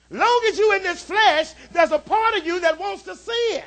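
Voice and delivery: loud, rising preacher's shout; preaching at full force. Acted. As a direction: call it out to the congregation.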